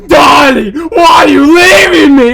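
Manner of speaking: loud, wailing mock heartbreak; hammed-up anguished shouting; melodramatic pleading. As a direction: beg a lover not to leave, at full volume. Acted plea to departing darling.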